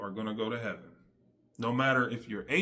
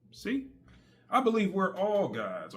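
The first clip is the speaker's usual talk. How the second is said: normal talking voice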